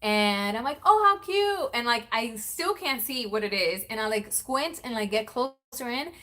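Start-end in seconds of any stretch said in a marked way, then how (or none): 0.9-1.7 high, loud gushing at a present; delighted squeal. Retold or imitated. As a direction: gush over a gift from the kids. Retold delighted reaction.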